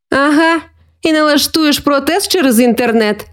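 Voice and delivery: put-on high, needling boy's voice; teasing with a smile. A narrator's reading. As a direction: poke fun at your sister. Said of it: "з посмішкою спитав Микита, підколюючи сестру". (насмішкувато, підколюючи)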